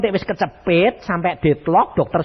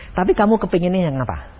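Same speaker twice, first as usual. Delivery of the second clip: usual speaking voice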